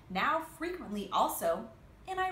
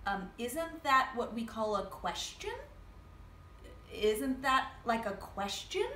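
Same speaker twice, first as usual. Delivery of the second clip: "question?" ending on a rising tune; "isn't that, like, a question?" lilting up at the end; uptalk. Shown as a demonstration. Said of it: "See what I did with my voice there?".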